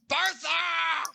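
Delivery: high, loud call across a room; yelling. Acted. Barkeep calling the barmaid over the bar, yelling. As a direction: holler for someone in the back. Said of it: "yelling over the top of the bar".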